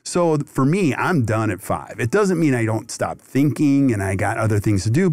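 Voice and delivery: ordinary talk, nothing marked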